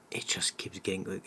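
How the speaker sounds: unvoiced whispered repeat; whispering. Real, not acted.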